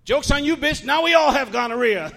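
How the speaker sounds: high, loud mock retort; triumphant comeback. Comic to the ex who confessed, loud and triumphant. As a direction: fire back loudly with a crowing payoff.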